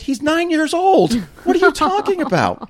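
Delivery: stunned, can't believe she said it; sharp high disbelief at a grown woman; incredulous retort. Retold or imitated.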